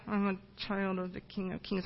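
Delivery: very soft, low-key mumble; timid first answer. Retold or imitated. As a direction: mumble it shyly, barely audible. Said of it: "I make her say it louder".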